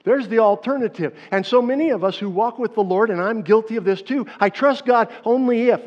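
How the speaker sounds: loud, high preaching push; full-force sermon build; fervent preaching. Acted. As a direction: drive it home to the congregation.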